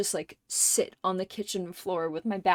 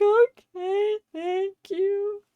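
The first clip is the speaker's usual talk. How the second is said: meek little thanks; 12 semitones higher, 4 dB louder, much slower than usual. Retold or imitated. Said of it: "I'm like".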